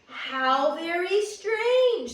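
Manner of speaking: slow, drawn-out puzzled bear voice; Papa Bear finding the door open, slow and wondering. Acted. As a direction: stretch it out, puzzled. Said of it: "said Papa Bear".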